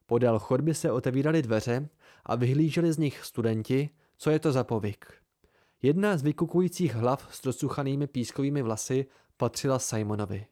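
ordinary delivery, nothing marked

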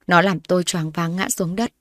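plain reading voice

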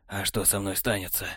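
cool technician. A narrator's reading. Technar answering Borland, low smug and soft.

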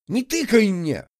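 high-pitched angry snap; indignant squeal. A narrator's reading. Offended rival snapping at the girl curator, indignant squeal. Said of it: "взвизгнул он".

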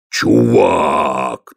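low troll voice, triumphant. Acted. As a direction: proudly repeat the word. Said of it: "торжествующе сказал Детрит".

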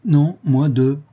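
cocky boast of two blows; cheeky retort. Acted. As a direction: correct him with a proud grin. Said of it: "repartit Anicet".

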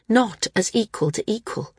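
ordinary delivery, nothing marked

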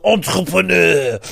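loud, throat-rolled French entrepreneur; hammed-up French accent. Mocking people who say entrepreneur, exaggerated French accent. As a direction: overdo the French grotesquely. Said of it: "with a French accent".

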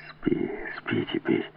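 slow, very soft whisper; soothing whisper. A narrator's reading. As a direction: lull someone to sleep in a low hush. He soothes her to sleep. (медленный убаюкивающий шёпот)

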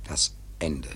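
whisper. Acted. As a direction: breathe the words like a dread secret. Dark foreboding aside.

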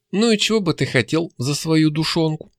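plain reading voice

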